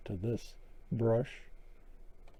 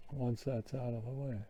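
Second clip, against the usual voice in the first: normal talking voice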